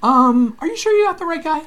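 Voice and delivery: high, fast, incredulous mock voice; doubting prophets. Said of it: "They're all like".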